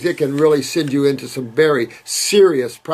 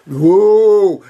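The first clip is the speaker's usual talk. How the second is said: alarmed whoa; 3 semitones higher, 6 dB louder than usual. Real, not acted. Reacting to dairy question.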